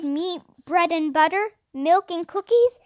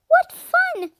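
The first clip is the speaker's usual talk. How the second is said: excited; 6 dB louder than usual. A narrator's reading. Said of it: "said Jessie".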